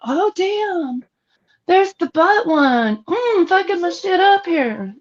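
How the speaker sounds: high, haughty aristocrat demonstration; very aristocratic. Shown as a demonstration. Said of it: "do it very aristocratically".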